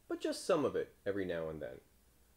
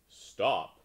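short, louder command; mild shout; 7 dB louder than usual. A narrator's reading. Frog halting the crow, restrained shout. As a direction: call out firmly, without full force. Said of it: "Jerome shouted".

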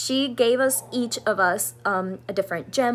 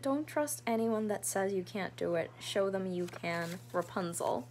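much softer, moved reading of the note; real hushed reading; 3 semitones lower, 10 dB quieter than usual. Real, not acted. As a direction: read it gently, holding back tears.

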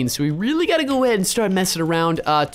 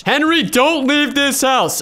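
high, slow, drawn-out plea; a haunted house warning, slow and high; dramatic pleading; 6 semitones higher, 4 dB louder, slower than usual. Retold or imitated. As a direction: beg him to stay, stretching it out. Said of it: "she said".

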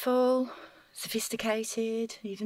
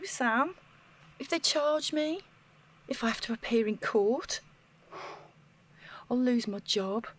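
normal talking voice

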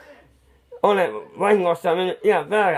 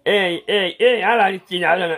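higher and louder repeated no; protesting cries; 6 semitones higher, 5 dB louder than usual. Acted. Painter protesting to the partner, loud urgent cries. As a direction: cry out to stop him.

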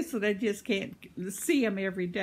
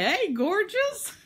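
much higher and louder, slow, teasing greeting; mock flirt to the mirror; 12 semitones higher, 6 dB louder, slower than usual. Joking to viewers about her mirror, a hammed-up flirty hello. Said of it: "I look in the mirror in the morning and say".

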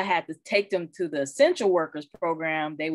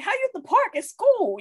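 much higher, louder disbelieving question; can't believe what she's hearing; incredulous; 3 dB louder than usual. Retold or imitated. Sister asking her brother how they're at the park during school, high and incredulous. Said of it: "I'm like".

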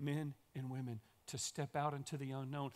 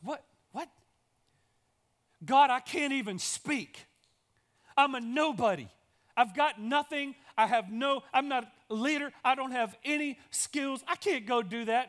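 panicked protest; 8 semitones higher, 8 dB louder than usual. Acted. Moses begging God that he cannot speak, panicked and loud. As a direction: beg out of it like he is terrified.

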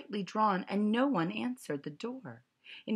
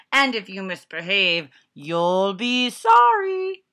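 menacing threat; 3 semitones higher, 12 dB louder than usual. A narrator's reading. Strict teacher threatening the kids, loud and slow. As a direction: drag out the threat.

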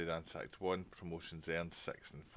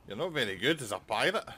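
loud, high sneering put-down; mocking gloat; 6 semitones higher, 10 dB louder than usual. Real, not acted. Gamer beats the enemy pirate captain, loud mocking gloat. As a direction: rub it in as the winner.

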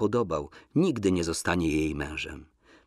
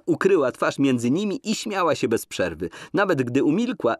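ordinary delivery, nothing marked

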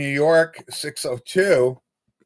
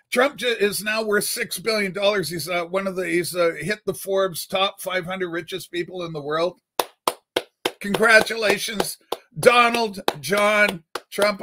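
usual speaking voice

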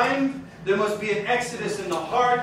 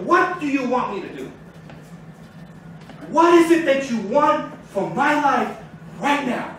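crying out to God; 4 semitones higher than usual. Retold or imitated. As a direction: act out a desperate plea. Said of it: "I am screaming to the Father like this".

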